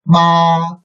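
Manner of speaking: Ma held high and flat; first tone. Shown as a demonstration. Teacher models Ma for repetition, high flat first tone. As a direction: sustain Ma on a high, level pitch. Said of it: "read respectively under the first tone".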